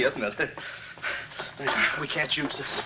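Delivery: ordinary talk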